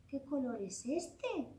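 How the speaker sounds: plain reading voice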